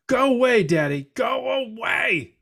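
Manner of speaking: dad mimicking his son telling him to go away; toddler voice. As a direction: squeal it like a cranky two-year-old.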